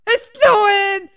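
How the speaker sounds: high, drawn-out dog shout; goofy snow-crazed yell; overjoyed dog voice. Acted. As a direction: holler it like a thrilled big dog. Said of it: "Like, that's how he was".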